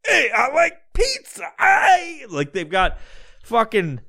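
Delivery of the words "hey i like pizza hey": put-on high, shouted Guido accent; pained mock Guido. Mocking contorted New Yorkers loving pizza, pained Guido accent. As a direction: yelp like a Guido in pain. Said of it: "they're fucking hurting and shit".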